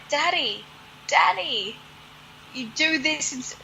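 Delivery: put-on high, pleading girl's voice calling daddy; pleading little daughter. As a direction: beg him like a frightened little girl. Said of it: "and be like".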